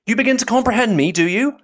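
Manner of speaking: high, loud and delighted; exclaimed question. A narrator's reading. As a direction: cry out, turning to a listener. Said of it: "cried he, turning towards her".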